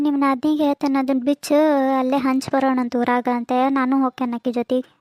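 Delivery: ordinary delivery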